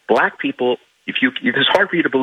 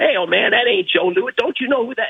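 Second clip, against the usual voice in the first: higher, scolding voice; correcting crowd; 13 semitones higher than usual. Retold or imitated. Onlookers scolding the old man, higher and pushy. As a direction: call out to correct him. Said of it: "everyone started correcting".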